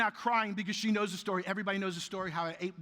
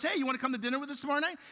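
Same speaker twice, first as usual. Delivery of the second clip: cheery invite; 7 semitones higher, much faster than usual. Retold or imitated. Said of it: "My daughter goes".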